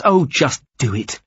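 exasperated insistence; impatient. Acted. Captive cuts him short.

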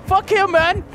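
high screaming laugh; panicking gamer. Real, not acted. Hit by the killer.

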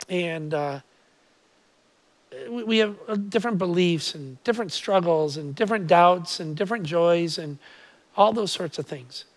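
quiet, earnest address to God; prayer. Real, not acted. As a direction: pray softly with thoughtful pauses. Leads opening prayer.

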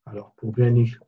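usual speaking voice

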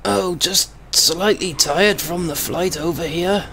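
put-on a frail old gentleman, voice squeezed in the throat; old man voice. Old man voice for the traffic cop.

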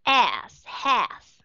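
"As, has" with an open E-like vowel; teacher demoing "As, has"; model short A words. Shown as a demonstration. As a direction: say "As, has" clearly and separately. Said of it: "Nó là âm E".

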